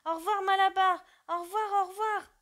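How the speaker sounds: high, loud farewell cries; shouting in chorus. Acted. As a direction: call out goodbye to the departing van. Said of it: "criant en chœur".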